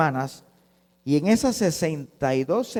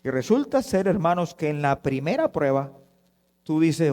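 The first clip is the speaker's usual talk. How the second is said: ordinary talk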